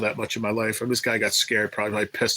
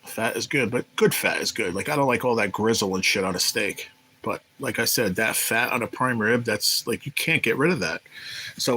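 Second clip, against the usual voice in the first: ordinary talk, nothing marked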